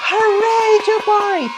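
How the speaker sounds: put-on cheering kids. Kids celebrating the hero's jump, cheering. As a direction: cheer like delighted children. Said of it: "all the kids usually go".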